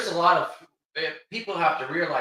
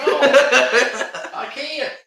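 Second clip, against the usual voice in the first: laughing through the words; a real laugh breaking into the line; 10 semitones higher, 7 dB louder than usual. Real, not acted.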